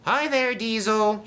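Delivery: very high, loud greeting; shouted. A narrator's reading. Dart greets Diesel, high happy shout. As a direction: call out hello right up high. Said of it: "shouted Dart".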